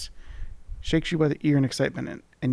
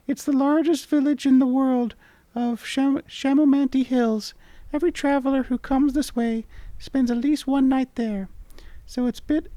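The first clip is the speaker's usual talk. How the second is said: much higher, squeaky sprite voice; charming tiny creature; 12 semitones higher, 4 dB louder than usual. Acted. Tiny creature charmingly greeting the traveller. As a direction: chirp it like a tiny fairy. Voicing the mini mite.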